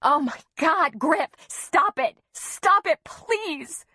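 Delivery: very high, desperate plea; screeching. A narrator's reading. Mother begging husband to stop, panicked screech. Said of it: "Antonia screeched".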